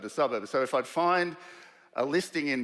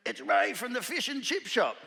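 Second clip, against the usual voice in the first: high, bright phone greeting as Ray; a high-pitched mate on the phone; Ray's voice; 9 semitones higher than usual. Retold or imitated. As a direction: call out cheerily as an old mate. Ray introduces himself on phone.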